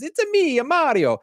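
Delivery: high, loud video game Mario voice; Mario impression. Uncle in the game suddenly sounding like Mario, high and loud. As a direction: squeal it out like the cartoon plumber. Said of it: "he even says it that way".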